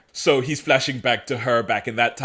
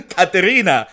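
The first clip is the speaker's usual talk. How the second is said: sharp gasp then louder cry of "Katerina!"; startled gasp; 8 semitones higher, 5 dB louder than usual. Retold or imitated. Elijah waking startled, seeing Elena, startled cry. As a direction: wake with a start and call out.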